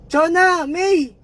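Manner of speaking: high, loud call for her daughters; mother calling out. Retold or imitated. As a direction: yell the names across a shaking town. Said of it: "sabi niya". (tawag ng nanay sa mga anak)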